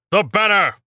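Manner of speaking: high, loud battle yell. A narrator's reading. Kale signalling the attack, high loud yell. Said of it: "Kale turned around and yelled".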